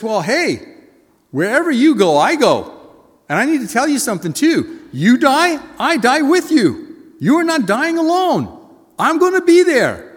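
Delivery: high, loud, passionate vow; fervent vow. Acted. Peter vowing loudly to die beside Jesus. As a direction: swear loyalty with everything you have.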